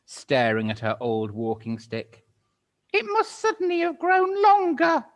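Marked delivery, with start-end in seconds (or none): 2.9-5.0 put-on much higher, loud, fussy old-woman voice; shrill old Missus Twit. Missus Twit startled, answering her husband in a high old-woman voice. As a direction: play a flustered old hag. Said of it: "Missus Twit said".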